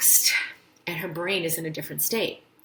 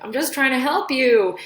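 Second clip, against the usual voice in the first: exasperated helper; 7 semitones higher, 7 dB louder than usual. Retold or imitated. Spouse's reply in quarrel.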